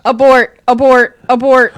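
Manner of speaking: loud urgent repeated call; urgent shout. Acted. Panicked abort call.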